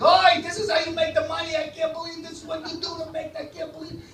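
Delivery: hammed-up rabbi losing it in a high voice; rabbi going ballistic. Rabbi watches priest collect money, acted ballistic outburst. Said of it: "The rabbi goes ballistic".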